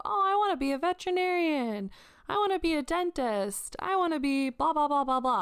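high, eager mock-kid voices; kids answering. Kids in music video say what they'll be, high childlike sing-song. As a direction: lift the pitch like schoolkids listing dream jobs. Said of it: "a bunch of kids".

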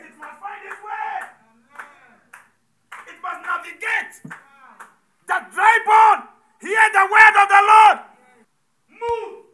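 high, loud prophetic cry; prophetic shout. Acted. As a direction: command the dry bones to rise. Preacher shouting prophetic command.

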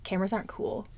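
hushed, scoffing imitation of her old self; dismissive inner voice. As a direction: mutter it like a self-conscious put-down. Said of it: "I was like".

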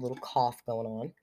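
ordinary talk, nothing marked

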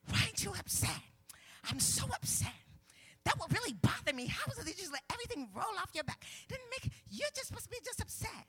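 panicking wife. Acted. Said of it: "something about when we panicking".

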